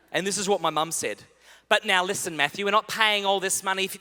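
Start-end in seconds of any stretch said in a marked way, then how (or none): 1.7-4.0 a hint of mum's Irish-style accent, louder; lightly voiced stern mother's accent. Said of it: "this is her accent".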